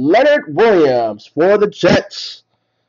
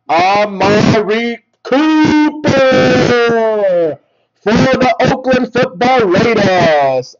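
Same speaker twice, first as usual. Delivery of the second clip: excited announcement; 5 semitones higher, slower than usual. Real, not acted. Excited pull of favorite player.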